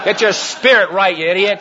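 mock rebuke from above; mock scolding. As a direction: jokingly berate yourself as Jesus would. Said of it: "Jesus was up there giving negatives".

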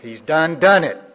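DONE, DONE punched loud and high; emphatic exclamation. Shown as a demonstration. Preacher repeating the South Carolina saying to the congregation, emphatic exclamation. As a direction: hammer both words "done, done" at full preaching force. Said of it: "That's the way we say it in South Carolina".

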